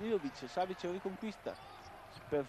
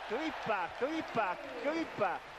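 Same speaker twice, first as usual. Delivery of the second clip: excited repeated call; 3 semitones higher, slower than usual. Real, not acted. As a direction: call the name, building each time. Repeated name call, rising.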